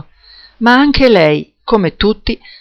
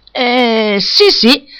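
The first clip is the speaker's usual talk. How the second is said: mumbled; 5 semitones higher, much slower than usual. A narrator's reading. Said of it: "farfugliai io".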